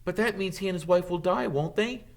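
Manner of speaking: put-on high, fast, anxious boy's voice; dismayed Harry. Harry asks Dumbledore about Flamel, high and worried. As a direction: rush the question out in alarm.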